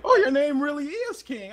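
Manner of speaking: high, loud laughing surprise; girl's voice. Retold or imitated. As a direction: exclaim it delighted. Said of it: "she goes implied".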